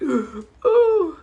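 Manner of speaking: high, loud "ooh" after a gasp; wordless high-pitched cry of being moved; emotional pang. Shown as a demonstration. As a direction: imitate being hit in the chest by feeling. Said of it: "it hits me in my chest".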